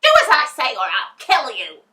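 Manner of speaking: high, loud witch scream. A narrator's reading. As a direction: scream the threat at the girl. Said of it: "she screamed at Dorothy".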